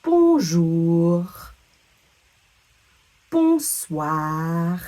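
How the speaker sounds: "Bonjour" and "Bonsoir" ending in a back-of-throat R; slow, careful demo of final French R; throaty French R. Shown as a demonstration. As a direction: draw out each word into a French throat R. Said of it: "sound in the back of our throat".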